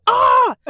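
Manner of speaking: sudden high yelp of fright; startled scream. Retold or imitated. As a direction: let out a sharp startled shriek. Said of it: "I go".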